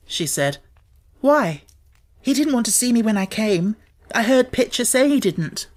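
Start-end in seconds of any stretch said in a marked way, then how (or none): 1.2-5.7 high, tense question, a little louder; alarmed. A narrator's reading. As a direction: blurt it out, worried. Mary alarmed, turned pale.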